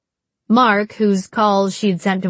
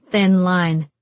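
plain reading voice

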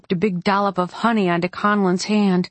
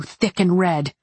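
usual speaking voice

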